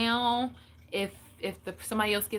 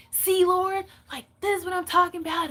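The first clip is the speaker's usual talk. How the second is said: complaining to God; 8 semitones higher, 4 dB louder than usual. Retold or imitated. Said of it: "but inside I'm like".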